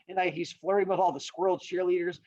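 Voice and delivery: ordinary delivery, nothing marked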